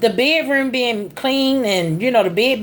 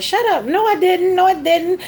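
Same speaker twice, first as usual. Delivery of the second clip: insistent, higher retort, retold; mock defensive denial; 8 semitones higher than usual. Grandson answering the little tattler, mock defensive. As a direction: imitate a child's high-pitched protest.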